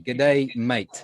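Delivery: broad Australian "G'day, mate" again, cueing the class. Shown as a demonstration. Teacher cueing the class to repeat an Australian "G'day, mate". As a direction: prompt them with an Australian greeting. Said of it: "So everybody repeat".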